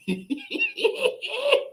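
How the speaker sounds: squealing laugh; high joyful laugh. Real, not acted. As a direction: let out a delighted high laugh.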